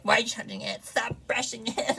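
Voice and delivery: mock whiny critic; mocking nagging voice. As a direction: mimic a nosy critic. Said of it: "so don't even be like".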